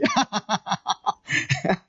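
laughing at own joke; rapid, amused laughter; real laugh. Real, not acted. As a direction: let a long chuckle out after the punchline.

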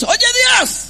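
high, loud bratty protest; hammed-up childish demand; mock tantrum. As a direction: shout it like an imitated tantruming child. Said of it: "un niño berrinchosos".

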